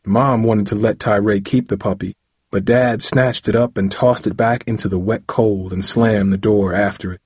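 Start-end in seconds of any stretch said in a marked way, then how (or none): none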